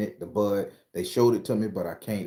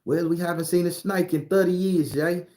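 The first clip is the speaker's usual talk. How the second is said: higher-pitched mock Australian accent; 9 semitones higher, 3 dB louder than usual. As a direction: toss it off in a jokey Australian accent. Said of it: "them Aussie people gonna be like".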